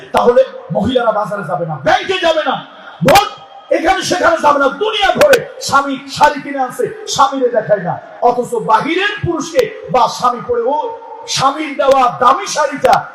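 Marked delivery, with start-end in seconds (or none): 1.8-13.1 fiery preaching. Acted. Preaching at full force.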